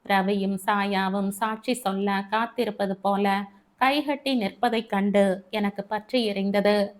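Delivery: ordinary delivery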